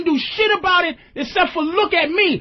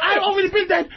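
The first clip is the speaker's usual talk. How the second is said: normal talking voice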